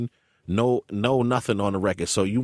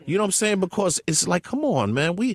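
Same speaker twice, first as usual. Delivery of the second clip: upset; 8 semitones higher than usual. Real, not acted. Upset about Marley, heated.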